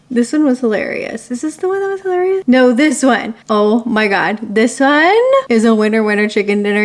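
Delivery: normal talking voice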